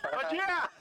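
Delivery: bright laughing shout. Real, not acted. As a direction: shout the hello high, laughing.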